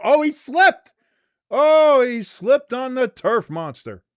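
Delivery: high, loud surprised cry, then stretched; excited match call. Real, not acted. Commentator sees player slip on turf, surprised excited call. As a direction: exclaim as the player goes down. Calling a player's fall live.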